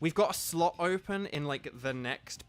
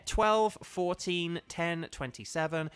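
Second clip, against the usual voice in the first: slow dictation; slower than usual. Real, not acted. Repeating the room code.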